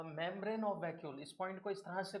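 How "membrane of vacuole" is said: very slow, word by word for notes; slow, spaced dictation. Shown as a demonstration. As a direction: dictate it slowly so they can write. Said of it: "इस तरह से लिखवा देता हूँ".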